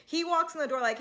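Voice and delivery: normal talking voice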